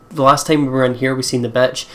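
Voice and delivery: ordinary talk, nothing marked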